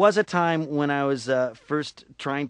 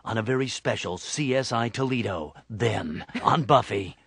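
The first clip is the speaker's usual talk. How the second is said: low promo voice, slowing on the turn; TV promo announcer; 5 semitones lower than usual. Acted. Comedian spoofing TV promos, announcer voice. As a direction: give it portentous promo weight. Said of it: "Like in promos".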